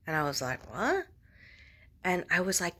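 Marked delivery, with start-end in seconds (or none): 0.7-1.1 high rising startled what; surprised what. Retold or imitated. To the psychic, a startled high what. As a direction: yelp it in surprise. Reacting to monetize advice.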